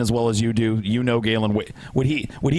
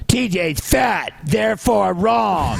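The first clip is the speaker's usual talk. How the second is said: slow, high mock proclamation; mocking declaration; 8 semitones higher, much slower than usual. Parodying an opponent's argument, slow mock pronouncement.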